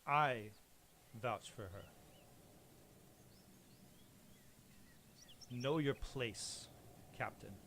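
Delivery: slow quiet stern lord to the captain; quiet authority. Acted. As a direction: put the captain in his place. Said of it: "He says".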